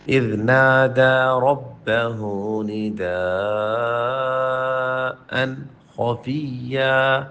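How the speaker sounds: slow, melodic tajweed recitation; Quran recitation. Acted. Teacher reciting the verse for students, slow and melodic. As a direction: draw each word out in a chanted tajweed line.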